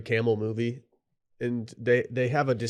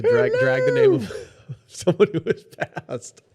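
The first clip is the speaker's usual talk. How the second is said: high giggly voice, slower; laughing; 4 dB louder, slower than usual. Real, not acted. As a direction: laugh through the words. Laughing while speaking.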